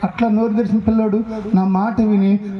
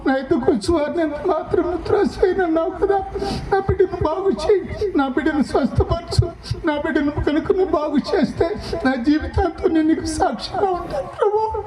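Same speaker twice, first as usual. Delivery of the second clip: much higher, sobbing plea, voice breaking; crying prayer; 7 semitones higher than usual. Real, not acted. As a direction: weep through the prayer. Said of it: "అని ఏడ్చి". (ఏడుస్తూ వేడుకోలు)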